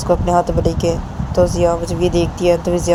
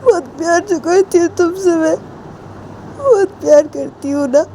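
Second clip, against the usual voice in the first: high wailing confession through tears; crying Ritu; 7 semitones higher than usual. Acted. Ritu cries to Jiya confessing love, high wailing voice. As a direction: sob out how much you love her. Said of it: "रोते रोते ऐसे बोलती है".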